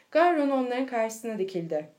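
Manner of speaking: usual speaking voice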